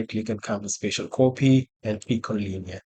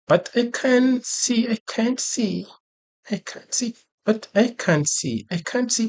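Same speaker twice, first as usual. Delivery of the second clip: much higher, singsong repetition; playful high voice; 12 semitones higher than usual. Pretend. Teacher clowning over what he can't see, playful high voice. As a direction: play it up in a high-pitched voice. Playful repeating, then asks isn't it funny.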